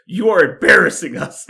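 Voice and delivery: loud, indignant retold mother; mock scolding. Mother tells daughter off in retold episode, mock scolding. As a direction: scold hard, acting out the mom.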